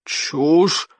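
drawn-out, restrained bellow; angry roar. A narrator's reading. Chieftain rejecting the boy's flat-earth talk, angry roar. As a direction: burst out on one word. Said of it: "взревел Норберт Сумасброд".

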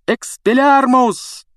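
high, loud, drawn-out spell cry; shouted spell. A narrator's reading. Harry casts at Snape, yelling the spell. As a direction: fire the spell with everything. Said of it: "крикнул он".

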